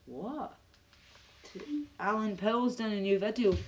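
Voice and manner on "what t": usual speaking voice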